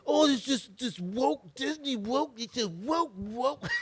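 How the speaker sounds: high, drawn-out mock whining; mocking complainer. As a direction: parody a complainer stuck on one word. Mimicking complainers about woke media.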